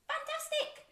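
high-pitched cheer; excited praise. Pretend. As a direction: praise small children with an excited squeal. Praises listeners for switching ears on.

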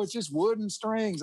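mild mock indifference; offhand Jerry. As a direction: toss it off like it's nothing. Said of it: "he goes".

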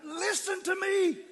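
slow, high, loud scream of each word; desperate drawn-out yell. Retold or imitated. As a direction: scream it so he finally hears. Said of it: "I had to scream it about seven times".

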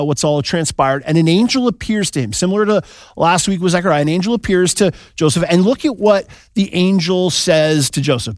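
ordinary talk, nothing marked

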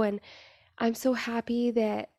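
normal talking voice

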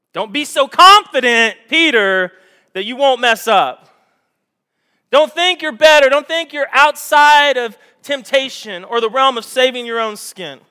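loud, high full-force exhortation; preaching. Real, not acted. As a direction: drive it home to the congregation.

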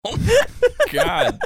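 laughing hard. Real, not acted. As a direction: crack up. Cracking up.

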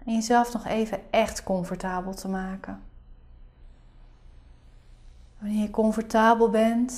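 soft spa whisper. Acted. Leads a guided meditation.

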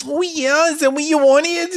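put-on very high breathy baby voice; half [name], half baby. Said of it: "half [name], half baby".

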